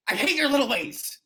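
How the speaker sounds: high, loud playful outburst; hammed-up lovers' spat; teasing complaint; mock squabble.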